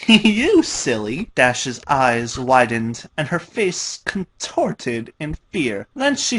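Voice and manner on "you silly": giggly teasing. A narrator's reading. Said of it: "Pinky giggled and responded".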